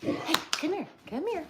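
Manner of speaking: high, coaxing call to the dog; pet talk. Real, not acted. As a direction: coax the dog over sweetly. Calling dog over.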